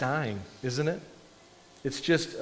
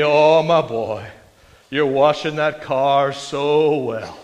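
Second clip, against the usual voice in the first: put-on slow, louder, booming old-time preacher; slow, louder old Baptist preacher drawl; W A Criswell impression; 5 dB louder, slower than usual. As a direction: savour each word like a grand Southern pulpit voice. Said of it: "when he would talk like this".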